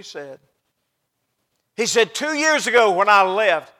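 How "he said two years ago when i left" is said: loud, declaimed retelling; forceful preaching. Real, not acted. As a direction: proclaim the son's words. Preaching outburst.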